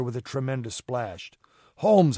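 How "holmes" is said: short higher, louder exclamation; cried out. A narrator's reading. Watson to Holmes as the mate goes overboard, cried out. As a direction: cry out the name in alarm. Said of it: "I cried".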